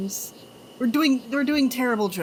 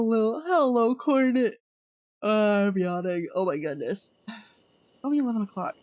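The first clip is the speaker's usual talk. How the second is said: normal talking voice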